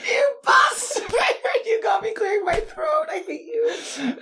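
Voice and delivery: high, shouting through laughter; laughing outrage. Real, not acted. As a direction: yell at her, half laughing. Mock outrage at throat clearing.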